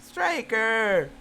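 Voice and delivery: loud dismayed cries; loud anguished outburst; shocked outcry. Real, not acted. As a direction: cry out at the death. Hamster dies in story.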